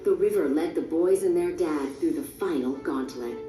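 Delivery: plain reading voice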